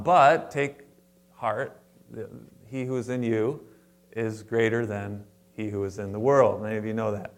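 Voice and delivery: ordinary talk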